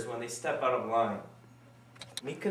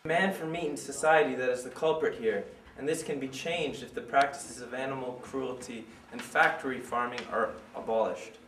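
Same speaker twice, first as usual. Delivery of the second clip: ordinary delivery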